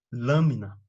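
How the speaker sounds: "Lâmina" stressed as LÂ-mi-na; stress on first syllable. Shown as a demonstration. Teacher models an example, stress on LÂ of "Lâmina". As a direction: lean on LÂ in "Lâmina", let the rest fall. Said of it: "sílaba tônica é a antepenúltima".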